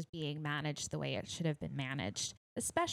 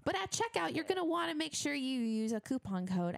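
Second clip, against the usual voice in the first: ad read; 10 semitones higher, faster than usual. Acted. Reads a sponsor ad.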